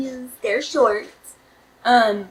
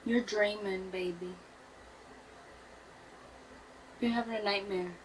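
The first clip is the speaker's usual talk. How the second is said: soft soothing murmur; 15 dB quieter than usual. Retold or imitated. Softly waking her sleeping partner from a nightmare, soothing. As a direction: hush a dreaming partner, softly.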